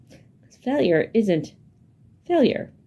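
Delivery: second "failure" jumps high with a falling finish; stress on second failure. Shown as a demonstration. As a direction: land hard on the last "failure". Teacher reframing failure for kids.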